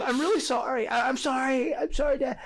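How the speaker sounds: dad imitating his daughter saying sorry; little girl apologizing. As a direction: lift the pitch like a small contrite girl.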